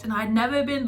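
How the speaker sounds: normal talking voice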